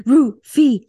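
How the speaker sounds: normal talking voice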